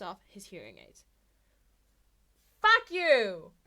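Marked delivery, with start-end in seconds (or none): 2.6-3.5 full-throated furious scream; all-out sonic shout. Acted. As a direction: blast it out to knock them down. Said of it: "defend them by screaming".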